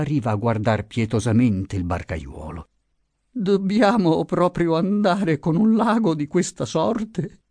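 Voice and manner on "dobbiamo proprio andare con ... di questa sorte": put-on high, thin frightened old woman's voice; fearful plea. A narrator's reading. Old wife afraid of the stormy lake, frightened plea. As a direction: ask timidly, scared of the lake.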